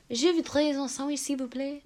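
softly trailing French accent; demonstrating French, smooth and connected. Shown as a demonstration. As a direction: glide through it in smooth French. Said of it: "French is very smooth".

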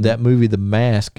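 ordinary talk, nothing marked

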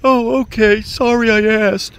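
high, wounded server voice; high, sulky apology from the waiter; hurt waiter. Retold or imitated. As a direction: play the server taking offense. Waiter replies, stung.

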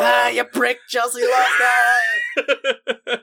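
shouting through laughter; angry shout. Retold or imitated. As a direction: yell it, half laughing, half crying. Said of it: "now it's like".

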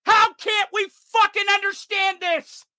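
high, slow furious yell; angry shout. Real, not acted. Ranting vlogger yelling at lawmakers, furious shout. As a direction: yell it in disbelief. Furious rant about gun politics.

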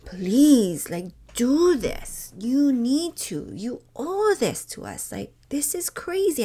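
high, loud, begging outburst; impassioned plea. Retold or imitated. Retelling her plea to the mayor, high and loud begging. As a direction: plead with everything you've got. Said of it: "my whole soul just was like".

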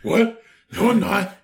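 mock indignant Batman; flustered Batman denial. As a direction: play a caught-out hero denying it. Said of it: "He's like".

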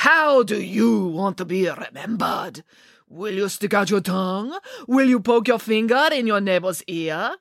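a showy photographer holding court, unhurried; Boris voiced. Boris addresses the children.

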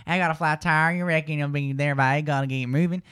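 Southern US accent. Shown as a demonstration. Host demonstrates a Southern accent. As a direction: talk like someone from the American South. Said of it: "The South".

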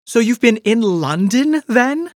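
high, loud sudden blurt with rising end. A narrator's reading. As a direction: let it burst out before she can stop it. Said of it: "blurted out Polly".

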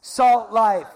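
loud rallying call of Salt life; crowd call-out. Shown as a demonstration. Pastor leading all campuses in a chant, loud rallying call. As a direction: shout it out to lead the crowd. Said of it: "Say it together".

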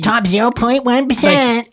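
high, slow put-on brag; mock boasting. Imagining his OnlyFans bio, high drawn-out mock boast. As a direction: draw out the boast in a high silly voice.

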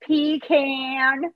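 long, drawn-out Texan "pecan", PEE-caaan; exaggerated slow Texan twang; mock Texan accent. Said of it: "that sounds more Texan".

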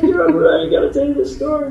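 high, fast and eager; bursting to share the story; excited. Retold or imitated. Calling her brother-in-law, excited and high.

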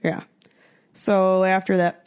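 usual speaking voice

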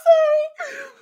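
high, loud apology through laughter; squealing with real laughter; helpless laughter. Real, not acted. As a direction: crack up while apologizing, drawn out. Breaks up laughing at orgies.

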